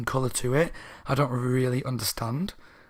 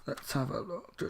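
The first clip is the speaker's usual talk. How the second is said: soft unvoiced mutter while looking; muttering to self; 16 dB quieter than usual. Real, not acted. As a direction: whisper it to yourself as you search. Muttering while checking the book.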